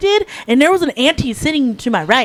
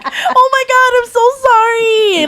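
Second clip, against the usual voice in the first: frantic apology; 10 semitones higher, slower than usual. Retold or imitated. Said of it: "And I'm like".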